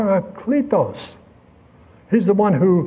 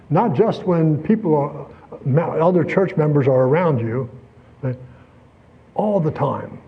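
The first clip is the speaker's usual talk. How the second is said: ordinary talk, nothing marked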